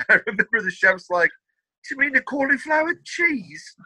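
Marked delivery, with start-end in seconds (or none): 1.9-3.8 high-pitched, incredulous chef impression; correcting chef. Chef querying the fancy dish name, high mock puzzlement. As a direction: put on a higher mock-puzzled voice.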